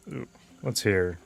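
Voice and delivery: usual speaking voice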